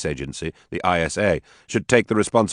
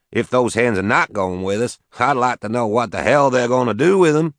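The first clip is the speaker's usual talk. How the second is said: put-on southern accent; 7 semitones higher than usual. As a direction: boast it in a broad Southern voice. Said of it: "He had a heavy southern accent".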